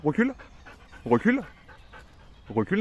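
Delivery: recule sung out high to the dog; high command to dog. Real, not acted. Trainer coaxing her dog Naya backwards, high. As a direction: coax the dog back with a high voice.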